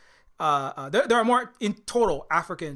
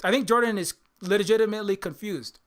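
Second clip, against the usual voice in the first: ordinary delivery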